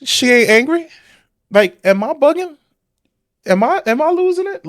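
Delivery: high, loud disbelieving questions; incredulous. Real, not acted. Host reacting to a clip, loudly incredulous. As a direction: question it as if you might be losing it.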